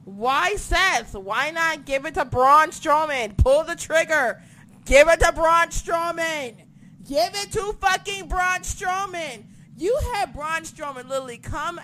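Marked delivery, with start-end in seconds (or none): 0.1-9.4 loud, slow frustrated rant; exasperated rant. Retold or imitated. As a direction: plead louder and louder. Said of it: "But I'm just like".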